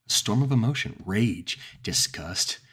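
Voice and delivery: plain reading voice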